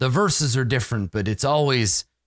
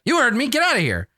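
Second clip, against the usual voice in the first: high snappy woman; 12 semitones higher, faster than usual. Acted. Tarina dismisses card players.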